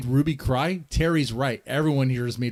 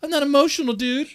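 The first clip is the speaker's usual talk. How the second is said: mock whiny squeak, much higher and louder; mocking high-pitched repeat; 13 semitones higher, 5 dB louder, faster than usual. Host mocking a guest's denial in high squeaky voice. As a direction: parrot it back in a high squeaky voice. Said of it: "Let's hear that again".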